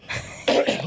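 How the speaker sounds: short dry cough to clear the throat; a quick cough from an itchy throat. Real, not acted. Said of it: "My, my, my throat is itchy".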